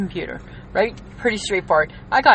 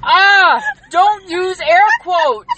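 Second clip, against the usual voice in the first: higher, louder, slow mock-furious cry; mock outrage; 11 semitones higher, 6 dB louder, much slower than usual. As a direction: scold her for the air quotes in hammed-up horror. Mock outrage over air quotes.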